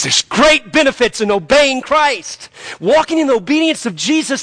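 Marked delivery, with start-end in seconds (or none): none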